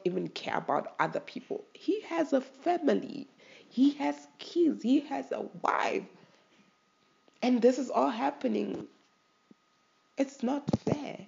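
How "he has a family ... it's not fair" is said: higher, halting voice close to tears; emotional. Real, not acted. Pleading for the injured employee's family, near tears. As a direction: hold back tears as you plead for him. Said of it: "I'm emotional".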